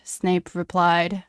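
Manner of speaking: usual speaking voice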